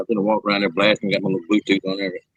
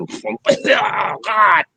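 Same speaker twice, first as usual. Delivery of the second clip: mock agony cry; 9 semitones higher, 3 dB louder than usual. Acts out the gas attack.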